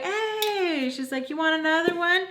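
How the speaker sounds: imitated carefree friend hailing someone; mock cheery greeting. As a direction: call hello in a high voice. Cheerful friend greets her, retold.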